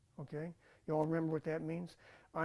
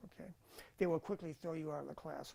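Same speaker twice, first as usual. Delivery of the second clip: ordinary delivery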